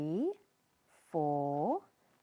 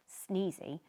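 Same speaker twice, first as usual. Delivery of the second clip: usual speaking voice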